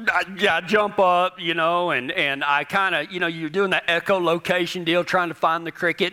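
ordinary delivery

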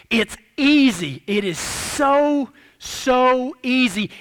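drawn-out, deliberate words, back at full voice; slow emphasis. Real, not acted. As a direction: stretch each word out to drive it home. Drawn-out emphasis after hushed warning.

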